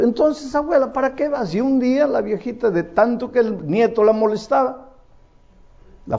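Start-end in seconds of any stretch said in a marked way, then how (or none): 0.0-1.5 higher, lightly mocking young voice; teasing grandson. Grandson teasing his forgetful grandmother, lightly mocking.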